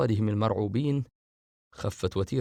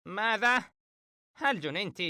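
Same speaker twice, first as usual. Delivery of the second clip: shouting; 11 semitones higher than usual. Acted. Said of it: "فهد صارخا".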